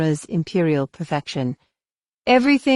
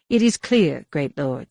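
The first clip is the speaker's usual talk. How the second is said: usual speaking voice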